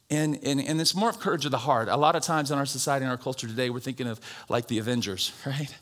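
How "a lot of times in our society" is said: ordinary talk, nothing marked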